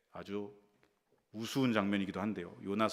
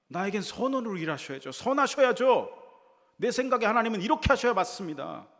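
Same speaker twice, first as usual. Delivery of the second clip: very high, loud indignant outburst; a prophet shouting his grievance upward; indignant demand; 8 semitones higher, 9 dB louder than usual. Acted. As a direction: rage at God for not obeying. Jonah's hidden demand voiced.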